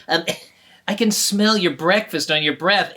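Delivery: usual speaking voice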